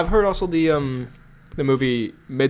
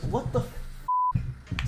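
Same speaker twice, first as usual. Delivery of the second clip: startled outburst; 11 dB quieter, much slower than usual. Real, not acted. Startled by noise outside.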